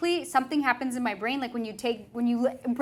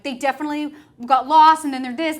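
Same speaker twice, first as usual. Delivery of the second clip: mock panic; 5 semitones higher, 12 dB louder than usual. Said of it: "I'm like".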